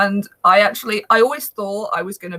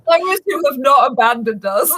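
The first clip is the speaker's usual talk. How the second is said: laughing; 5 semitones higher than usual. Real, not acted. Co-host joking about listeners left after the tangent, laughing. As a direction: joke said through laughter.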